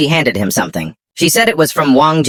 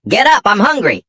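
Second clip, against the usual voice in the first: put-on little girl yelling; 9 semitones higher than usual. A narrator's reading. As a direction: pitch it up like a hungry kid demanding food. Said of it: "the little girl started yelling".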